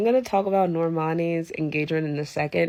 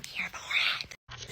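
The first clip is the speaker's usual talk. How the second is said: whispered; 9 dB quieter, much slower than usual. Real, not acted. Gushing about wedding season.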